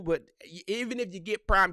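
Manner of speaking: ordinary delivery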